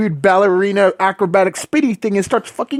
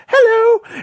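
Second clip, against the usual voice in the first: put-on high squeaky little-kid voice, much higher; creepy kid greeting. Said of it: "He goes".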